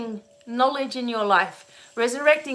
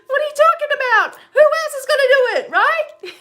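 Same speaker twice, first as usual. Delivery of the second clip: much higher, louder, fast indignant gabble; mock frantic defensiveness; 7 dB louder, faster than usual. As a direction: rush it out, hammed-up overwhelmed self. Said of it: "And I'm like".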